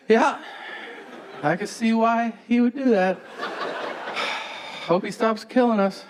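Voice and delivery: high, airy fake serenity; mock-serene yes-man tone; fake calm agreement. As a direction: agree breezily to dodge the fight. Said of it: "took a deep breath and I just went".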